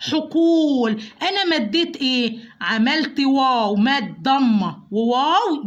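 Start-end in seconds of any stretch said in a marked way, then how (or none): 0.1-1.0 "حقول" with a long, held oo; full long vowel. Shown as a demonstration. Teacher gives the letter its due, stretched "حقول". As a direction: draw out the waw in "حقول". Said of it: "أدي الحرف حقه في النطق".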